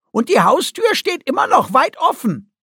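loud, high exclamation in a cartoon creature voice; squeaky-high and exclaiming; high-pitched creature voice. A narrator's reading. Guki comments aloud on the ship.

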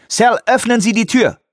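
high, urgent command; urgent shout. A narrator's reading. Peake demands escape from the breaking lab, urgent shout. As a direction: call out for the door to open.

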